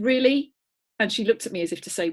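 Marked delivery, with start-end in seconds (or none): 0.0-0.5 high, loud questioning disbelief; mock incredulity. Owner to dog rejecting the blue mug, mock incredulous. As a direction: retell it as a loud, incredulous query. Retold disbelief at dog's pickiness.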